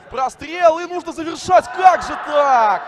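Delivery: rising shout at a near miss; excited shout. Real, not acted. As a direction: yell as the chance goes begging. Near miss in live commentary.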